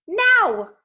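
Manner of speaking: high, loud single-word bark; barked command. A narrator's reading. Missus Dodds ordering Percy, loud barked now. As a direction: crack it like a whip.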